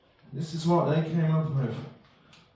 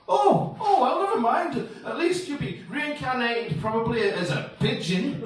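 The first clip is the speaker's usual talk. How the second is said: breezy consoler; 7 semitones higher than usual. Acted. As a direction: jump in with high-pitched reassurance. Consoling friend in acted dialogue.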